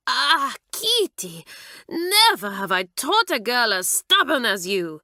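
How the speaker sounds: a French teacher crying out at her pupil; exasperated French mistress. Acted. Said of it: "she would cry".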